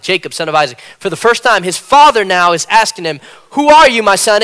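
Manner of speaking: loud, rising sermon delivery; a swelling pulpit voice; preaching. Acted. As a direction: preach it out to the congregation. Preaching at full force.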